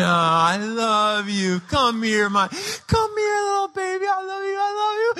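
slow, then climbing into a high squeal; smothering, overwrought mother love; frantic gushing affection. Retold or imitated. Mom clutching rescued children, frantic gushing affection.

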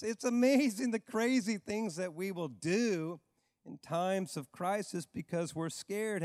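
normal talking voice

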